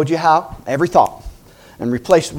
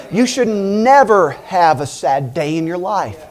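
ordinary talk, nothing marked

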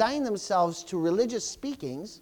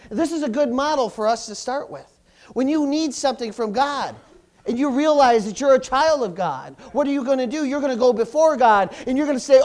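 louder, pressing sermon voice; full-force preaching; 3 semitones higher, 6 dB louder than usual. Acted. Preacher urging the church toward prayer, loud. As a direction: urge the church forward.

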